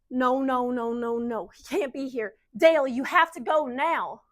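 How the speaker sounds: alarmed, insistent warning; panicked urging. Acted. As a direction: beg him to leave before he's caught. Urging Dale out before discovery.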